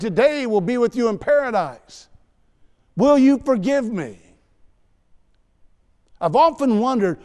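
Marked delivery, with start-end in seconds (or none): 2.9-4.2 pleading plea. Pretend. Imagined Judas pleading at cross.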